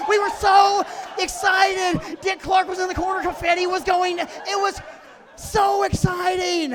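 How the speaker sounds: loud, high, breathless celebration; excited shouting. Acted. Comic tells the audience how they celebrated, loud and excited. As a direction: ride the joy up to a laugh.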